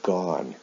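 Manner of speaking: "gone" with an open short o; modelled pronunciation. Shown as a demonstration. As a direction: say "gone" with an aw-like vowel. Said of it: "with go is pronounced".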